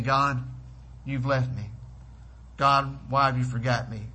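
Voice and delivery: usual speaking voice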